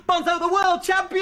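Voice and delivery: high, loud champion announcement; mock stadium hype; triumphant announcing. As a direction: call it out to a roaring crowd. Hyping himself, friend joins in mockingly.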